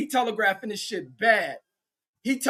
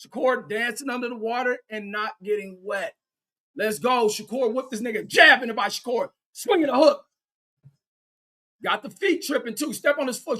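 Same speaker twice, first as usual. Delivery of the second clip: ordinary talk, nothing marked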